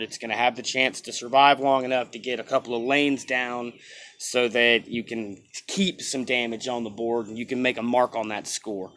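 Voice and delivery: ordinary talk, nothing marked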